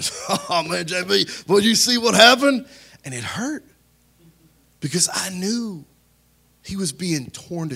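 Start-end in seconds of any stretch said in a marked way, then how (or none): 0.5-2.7 imitating gleeful gossips, laughing into the words; laugh, then build to a louder, higher gloat; mocking laughter. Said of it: "they were laughing".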